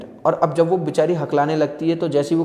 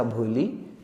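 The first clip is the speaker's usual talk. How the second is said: soft, lower and gentle, the name barely spoken; said lovingly; 4 semitones lower, 7 dB quieter, much slower than usual. Retold or imitated. Teacher calling Bholi gently, soft and tender. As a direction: say her name tenderly, softly. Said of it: "किसी ने प्यार से कहा".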